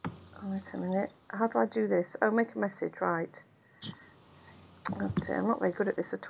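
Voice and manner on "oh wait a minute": ordinary talk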